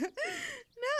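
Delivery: a high, loud derisive laugh; loud mocking laugh; scoffing laugh. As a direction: laugh the idea off.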